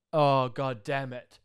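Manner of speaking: slow, drawn-out exasperated groan; loud fed-up mutter; annoyed groan. Retold or imitated. Annoyed buddy quoted.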